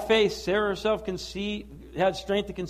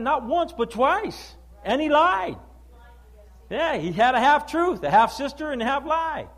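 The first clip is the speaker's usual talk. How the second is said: loud, driving pulpit preaching; full-force preaching; 3 semitones higher, 5 dB louder than usual. Acted. Pastor preaching hard to the church, loud and driving. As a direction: thunder it out to the congregation.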